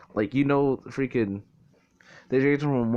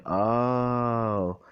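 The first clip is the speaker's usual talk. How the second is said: long drawn-out oh of understanding; stretched, level collective oh; mock realization. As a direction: imitate a class finally getting it. Class's chorus of realization retold.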